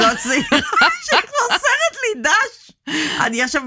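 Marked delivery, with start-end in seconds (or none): 0.8-2.7 high laughing squeal. Real, not acted. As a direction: burst out laughing on it. Laughing at the psychologist guess.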